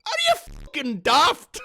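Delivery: high, loud outraged yell. Real, not acted. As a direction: blurt it out in disbelief. Outraged at the comment.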